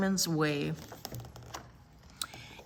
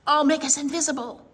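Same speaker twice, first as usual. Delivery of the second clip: quick, higher, louder promise; eager announcement; 7 semitones higher, 5 dB louder, faster than usual. Acted. Willa promising Jenny an escape, eager and quick. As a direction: rush it out with excitement. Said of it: "Willa said".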